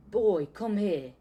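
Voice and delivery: put-on loud, slow roar of Boy, come here; furious bellow. A narrator's reading. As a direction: summon the boy in a rage. Vernon bellows at Harry.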